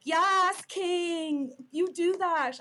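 high, loud mock cheer; exaggerated gushing encouragement; imitating adoring fans. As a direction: whoop like a hyped-up stan. Voices cheering fans in retelling.

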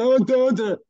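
high, hammed-up dopey "oh, duh"; sneering parody of a dim reaction; mock dumb voice. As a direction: play the clueless guy, mocking him. Mocking staff's dumb reaction.

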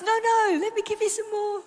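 high, loud playful voice of God; eager insistence. Acted. God keeps lavishing love on the believer, eager insistence. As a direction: insist generously, pitched right up.

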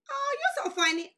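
put-on high, sweet girlfriend voice; hammed-up sweet girl; girly mock reply. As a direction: imitate her giggly brush-off way up high. Girlfriend laughs it off.

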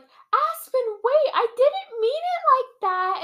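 very high, loud put-on wail; hammed-up call after someone leaving; mock pleading. Retold plea to departing man.

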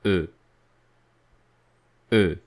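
E said as a French schwa; teacher isolates E; French E sound. Shown as a demonstration. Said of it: "the sound that we have practiced".

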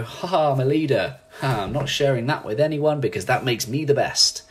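hammed-up boastful leader; mock gloating. Leader refusing to share knowledge, mock gloating. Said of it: "read this book and go".

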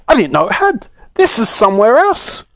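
high-pitched dismay; shrieked. A narrator's reading. Saucepan man in a strange land, high and dismayed. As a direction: protest in a high, upset voice. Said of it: "shrieked the saucepan man".

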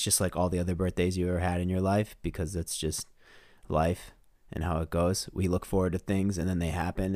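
ordinary talk

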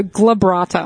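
"glabrata" said slowly with an initial g; corrective pronunciation. Shown as a demonstration. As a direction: correct them with a drawn-out "glabrata". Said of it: "trying to pronounce my surname".

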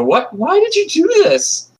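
put-on very high child's voice, a touch of tears; boy grieving over the big cat; tearful boy. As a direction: plead through the tears. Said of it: "choke through these tears and go".

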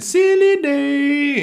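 high, slow, sing-song sweetness; sweetly. A narrator's reading. Brothers sweetly reminding their dad of the rules. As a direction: sweeten it like innocent kids. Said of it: "looked at each other very sweetly and said".

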